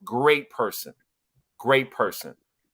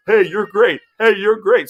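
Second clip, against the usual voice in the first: higher, louder mock praise; mocking flatterer; 5 semitones higher, 7 dB louder than usual. Podcast host mimicking people who trade empty praise, mocking. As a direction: parody empty back-patting. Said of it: "where people just say".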